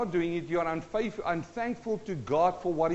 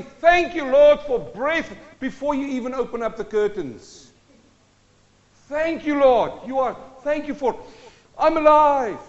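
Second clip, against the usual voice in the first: high, loud, glad praise; joyful thanks; 6 semitones higher, 10 dB louder than usual. Retold or imitated. Believer thanking God on waking, loud and high with joy. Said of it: "if you stand up and you say".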